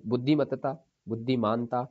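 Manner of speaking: ordinary talk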